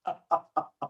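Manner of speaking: soft amused chuckle; light laugh after the line. Real, not acted. Imitating or sharing Yashoda's laughing.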